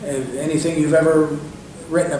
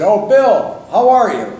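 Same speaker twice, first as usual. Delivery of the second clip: loud, higher-pitched greeting; familiar greeting; 8 semitones higher, 7 dB louder, slower than usual. Retold or imitated. Reg greeting a caller like he knew him, hearty. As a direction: hail him like someone you know. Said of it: "Like he knew me".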